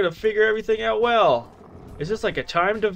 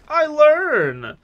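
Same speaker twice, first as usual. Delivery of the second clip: triumphant shout; 8 semitones higher, 7 dB louder than usual. Real, not acted.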